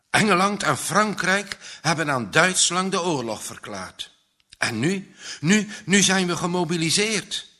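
put-on boy gushing the news, high and loud; loud, urgent outpouring; excited. A narrator's reading. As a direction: insist eagerly, unable to stop. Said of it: "weet niet meer van ophouden".

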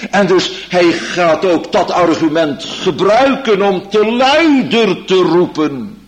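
loud, high pleading preaching; fervent preaching. Acted. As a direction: build to an urgent plea. Preaching at full force. (vurig en luid preken)

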